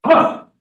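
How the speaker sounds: sharp, loud belly exhale "Ha"; loud ha breath. Shown as a demonstration. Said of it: "and then you go".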